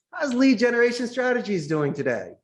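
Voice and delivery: bright, high sales-call hello; cheery caller asking about the company; upbeat cold-call greeting. Retold or imitated. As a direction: sound chipper and friendly on the phone.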